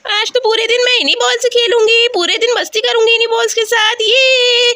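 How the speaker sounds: put-on high, excited child voice; high-pitched childish eagerness; eager little girl. Child gloats over her balls.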